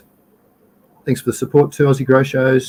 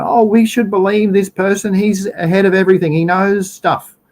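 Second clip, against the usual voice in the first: mock gullible voice, higher pitched; gullible old people; 7 semitones higher than usual. Host mimics old people trusting the police chief, mock gullible. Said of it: "you've got the old people that are going".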